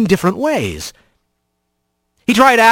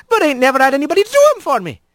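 put-on higher, fast young sailor girl; young otter bosun; 9 semitones higher, much faster than usual. Mavis telling Rat about her chores, fast and cheeky.

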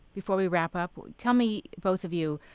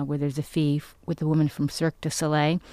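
normal talking voice

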